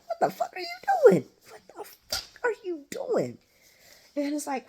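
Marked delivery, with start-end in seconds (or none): 0.0-3.4 high-pitched, hammed-up outraged demand; Valentine, angry. Imitating a father unhappy at the visit, high mock outrage. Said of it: "Valentine was not happy with that shit".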